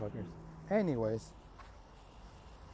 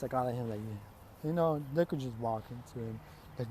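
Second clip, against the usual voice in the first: ordinary delivery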